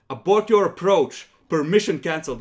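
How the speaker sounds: loud urgent radio call; radio shout. Acted. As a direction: bark the order over comms. Crew transmits over the vox.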